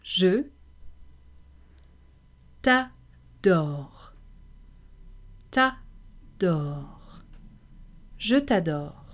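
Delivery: t'adore said slowly with a French r; slow French drill. Shown as a demonstration. Teacher drilling je t'adore for learners. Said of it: "Let's hear you say that".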